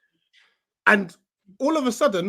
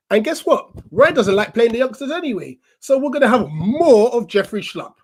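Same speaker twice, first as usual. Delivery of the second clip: sarcastic; 6 semitones higher, 6 dB louder than usual. Real, not acted. Sarcastic rant about manager.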